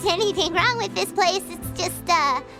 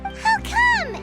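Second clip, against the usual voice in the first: surprised question; 10 semitones higher than usual. Acted. Surprised at refusal.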